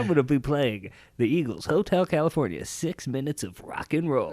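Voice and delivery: high, loud announcer voice; radio DJ voice. Pretend. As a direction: pitch it like a radio host. Mocking radio DJs.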